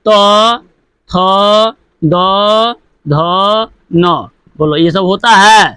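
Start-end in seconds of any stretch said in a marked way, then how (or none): 0.0-4.3 dental letters one at a time; slow spaced recitation. Shown as a demonstration. Teacher demonstrates dental letters to pupils, slow and spaced. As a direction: pause after each letter. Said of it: "तो देखिए, जैसे".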